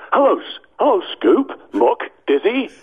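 high, cheery cartoon builder greeting; Bob the Builder voice. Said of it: "Bob the Builder".

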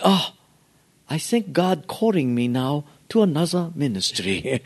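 exaggerated Japanese-accented broken English; mock bonsai master. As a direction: solemnly announce a convenient calling, as parody. Mocks pastors in the master's voice.

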